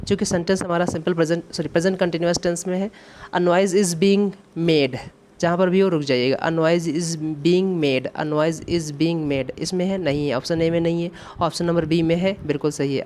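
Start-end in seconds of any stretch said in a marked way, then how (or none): 3.3-5.0 very slow, word by word; slow dictation. Shown as a demonstration. Teacher dictates the answer sentence. 6.5-9.6 very slow, word by word; slow dictation. Shown as a demonstration. Repeats for dictation.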